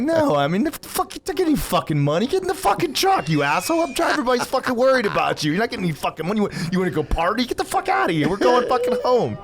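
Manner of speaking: high-pitched retold rant at a brother; exasperated tirade. Man ordering his runaway brother into the truck, exasperated high-pitched rant.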